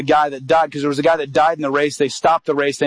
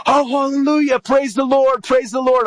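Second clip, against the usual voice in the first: joyful praising; 9 semitones higher than usual. Retold or imitated. Pastor on the phone rejoicing that Chad believed. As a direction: burst out rejoicing, hallelujah. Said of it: "This pastor just started praising the Lord".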